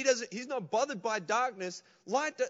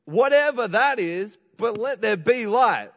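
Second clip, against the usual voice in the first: louder, slow, booming command; God's proclamation; 9 dB louder, slower than usual. Acted. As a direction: speak it like creating light. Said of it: "He just opens his mouth and says".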